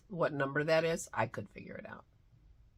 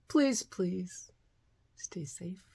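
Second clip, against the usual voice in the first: whispered plea; 2 semitones higher, slower than usual. Real, not acted. Vlogger begging viewers to stay safe, whispering. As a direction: plead gently in a whisper.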